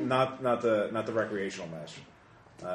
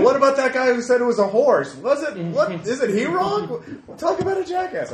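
high, loud provoking heckle; yelling; 6 dB louder than usual. Acted. Player's character stirring up a fight, yelled. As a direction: yell to start a fight. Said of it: "I yell".

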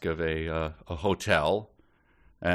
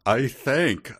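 mock Arabic accent; 6 semitones higher, 4 dB louder than usual. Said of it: "I said with a smile".

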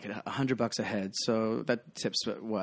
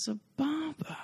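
much higher, soft drawn-out word; chill mentor; 5 dB quieter, slower than usual. Retold or imitated. Bartender imitating Ben handing him a shot, soft high voice. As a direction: say it like a blessing. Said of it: "and just goes".

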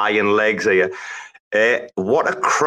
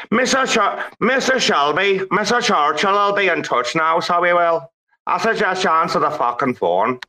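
high-pitched comic impression; character impression; 6 semitones higher than usual. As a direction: put on a character voice, pitched well up. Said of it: "you get impressions".

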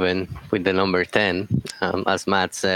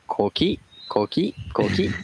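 coqui frog call. Retold or imitated. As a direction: chirp it like a tiny tree frog. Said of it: "It's like".

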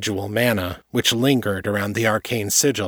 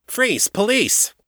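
sharp, much higher shouted command; police shout; 13 semitones higher, slower than usual. Acted. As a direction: bark it out like a cop storming in. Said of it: "her shout drifted up from below".

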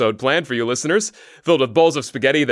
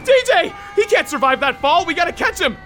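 frantic shouting. Acted. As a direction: shout to the firemen. Falling rescue shouts.

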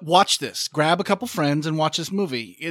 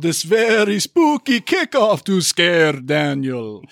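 slow, hammed-up horror announcer; mock-spooky, drawn-out delivery; spooky host voice; 3 semitones higher, 4 dB louder, much slower than usual. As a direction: stretch it out like a campy horror host. Hamming up the spooky sign-off.

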